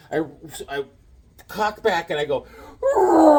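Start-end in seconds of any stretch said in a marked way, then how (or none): 2.8-3.4 high, loud throwing roar; mock war cry; battle roar. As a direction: let rip as you throw. Throwing the ice ball.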